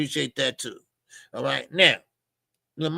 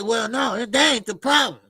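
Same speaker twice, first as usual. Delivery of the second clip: retold exasperated reply; 7 semitones higher, 4 dB louder than usual. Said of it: "They said".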